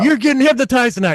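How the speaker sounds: high, eager crowd chatter; excited crowd. Retold or imitated. Audience buzzing before the show, high and excited. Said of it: "They're like".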